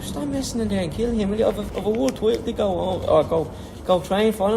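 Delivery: hammed-up corner instructions, demanding; retold coach pushing him to finish the fight; mock exasperated coach.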